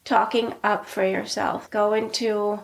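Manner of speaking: usual speaking voice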